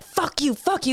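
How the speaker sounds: high imitated rage; mock snarl. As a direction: spit the words down at her.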